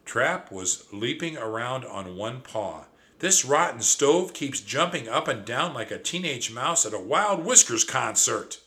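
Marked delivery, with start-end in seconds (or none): 3.2-8.6 louder, higher complaining shriek. Acted. Trap complains of the stove, loud shriek. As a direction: rant loudly at the stove. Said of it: "shrieked my cousin".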